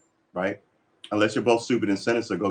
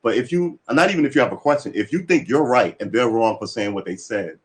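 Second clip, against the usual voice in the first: normal talking voice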